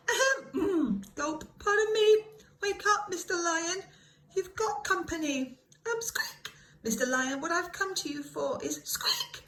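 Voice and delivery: high, squeaky, nervous little mouse voice; small mouse clearing its throat bravely; tiny mouse timidly waking the lion. Acted.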